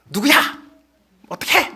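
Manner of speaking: high-pitched mock yell; mothers' outcry. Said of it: "막 이렇게 하는 외침 소리".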